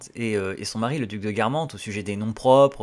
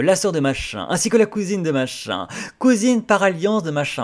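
higher and louder, rising with each name, like a society gossip; mock aristocratic name-dropping, building higher and louder; gossipy aristo chatter; 6 semitones higher, 6 dB louder than usual. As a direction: reel off the family links like a titled socialite. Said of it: "un petit côté très Stéphane Bern".

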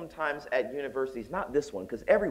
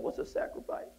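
put-on little child asking; 9 dB quieter than usual. Small child asking grandmother.

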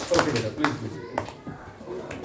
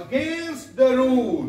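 slow, deliberate teacher reading; careful classroom dictation pace; slow model reading; 3 semitones higher, much slower than usual. Shown as a demonstration. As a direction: read it out slowly word by word. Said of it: "teacher models the line".

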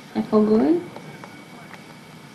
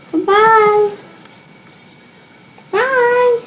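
put-on baby talk bye; 8 semitones higher, much slower than usual. Mom waving bye for baby.

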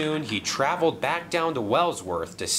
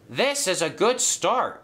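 higher-pitched pleased remark; happy; 7 semitones higher than usual. A narrator's reading. James finding the cars full and ready, happily. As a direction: lift the pitch, pleased with how it starts. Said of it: "he said happily".

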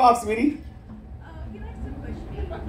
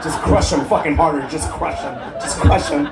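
low mock husband plea; 7 semitones lower than usual. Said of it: "Just tell him".

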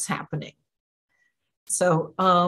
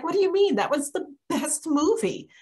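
high, incredulous protest; can't believe anyone disliked it; baffled disbelief; 9 semitones higher, faster than usual. Retold or imitated. To the angry friend, high incredulous disbelief.

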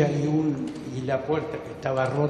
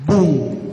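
disparo imitado; 6 dB louder than usual. Retold or imitated.

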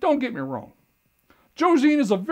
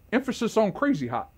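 ordinary talk

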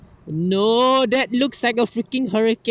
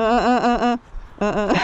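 normal talking voice

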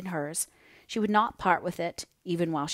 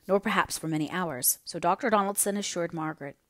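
ordinary delivery, nothing marked

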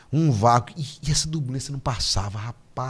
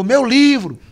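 mock shout; 11 semitones higher, 10 dB louder, much slower than usual. Mocking the atheist's books.

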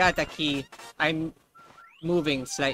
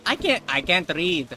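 ordinary talk, nothing marked

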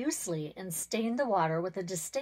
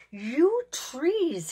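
shrill high screech, much louder; 7 semitones higher, 7 dB louder than usual. A narrator's reading. Pond spirit screeching at the trees that steal his water. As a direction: screech the words at them. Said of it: "he screeched".

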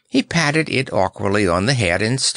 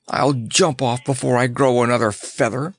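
mildly furious, higher voice; blustering fury; 4 semitones higher than usual. A narrator's reading. Lion threatening to jump off, furious bluster. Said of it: "stamping around in a fury".

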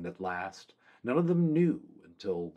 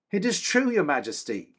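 high, bright-eyed joy; a soldier glad to be recognised; happy; 9 semitones higher, 5 dB louder than usual. A narrator's reading. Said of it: "said happily".

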